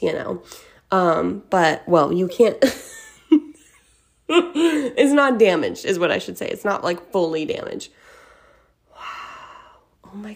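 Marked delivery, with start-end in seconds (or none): 2.6-5.8 laughing while speaking. Real, not acted. Laughs at the burnt desk. 8.9-10.3 stunned whisper; hushed, slow disbelief. Real, not acted. Shocked about burnt desk.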